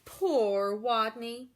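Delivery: ordinary delivery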